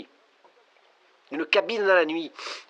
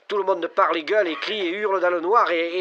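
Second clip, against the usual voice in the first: usual speaking voice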